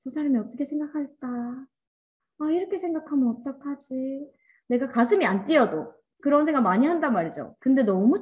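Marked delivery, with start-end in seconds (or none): 0.0-4.3 higher, mock-anxious inner voice; acted worrying. People-pleaser wondering what that person will think, mock worry. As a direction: fret over what others might think. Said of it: "착한 어린이병이 약간씩 있잖아요".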